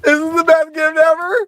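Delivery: high squeal breaking into laughter; helpless laughter. Real, not acted. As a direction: squeak it out, overcome with giggles. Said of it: "Okay, I gotta calm down".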